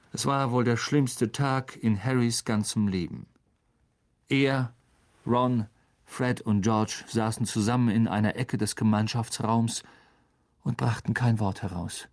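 ordinary delivery

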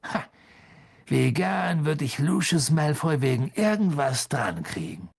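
vengeful relish after a short 'ha'; grim satisfaction. A narrator's reading. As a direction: grimly savour the wish. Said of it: "mit grimmiger Befriedigung".

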